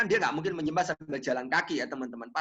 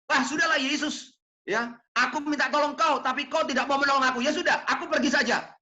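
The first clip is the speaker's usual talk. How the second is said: raised, hurt voice telling Jesus off; mock angry complaint; 5 semitones higher than usual. Woman snapping at Jesus before walking away, mock angry. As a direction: play the woman giving up on Jesus in a huff.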